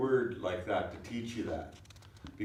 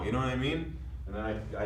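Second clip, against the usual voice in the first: normal talking voice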